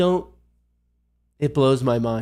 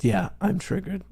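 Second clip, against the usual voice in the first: softer, whiny mock-hurt voice; mock triggered; 5 semitones higher, 6 dB quieter than usual. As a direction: pretend to be wounded, sarcastically. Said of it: "triggered mode".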